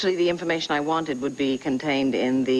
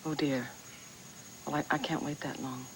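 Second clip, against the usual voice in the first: hushed dismay; 3 semitones lower, 12 dB quieter than usual. Acted. Caller told of a months-long delay, quietly dismayed. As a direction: softly let down, voice dropped.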